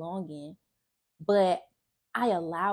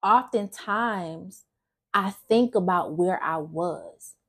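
usual speaking voice

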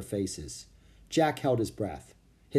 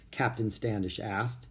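ordinary delivery

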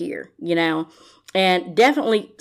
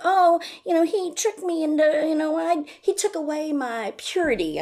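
much higher, breathy put-on victim voice; mocking Robin; 9 semitones higher than usual. Vlogger imitating Robin's purity complaint, high mocking voice. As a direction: play her wounded innocence, pitched way up. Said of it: "that was the thing of, like".

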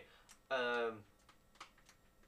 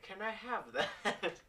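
high, drawn-out pleading through a laugh; real laughing request; 10 semitones higher, 4 dB louder, slower than usual. Real, not acted. Player asks the wizard for the staff, laughing. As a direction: ask slowly, pitched up, giggling.

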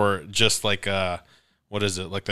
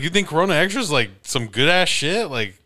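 incredulous; 7 semitones higher, 4 dB louder than usual. Retold or imitated. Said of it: "I was like".

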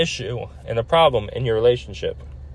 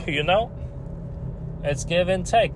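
ordinary delivery

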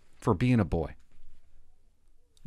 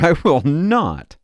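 laughing refusal; 5 semitones higher, 8 dB louder, slower than usual. Real, not acted.

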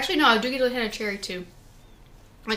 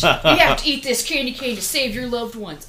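mock kidnapper; 4 semitones higher, 8 dB louder than usual. Voicing a hostage-taker's demand.

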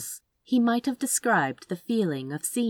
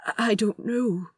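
timid; 5 semitones higher than usual. Acted. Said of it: "his voice timid and distant".

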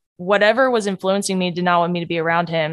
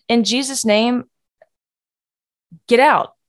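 commanding rebuke; 4 semitones higher than usual. Shown as a demonstration. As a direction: command it out with authority. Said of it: "just say".

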